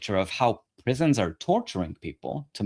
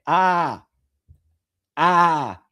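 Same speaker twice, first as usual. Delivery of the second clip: short loud frustrated cry, higher; exasperated yell; 4 semitones higher, 5 dB louder than usual. Real, not acted. Frustrated outburst after insult.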